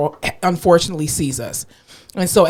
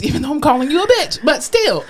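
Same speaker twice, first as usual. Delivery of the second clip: normal talking voice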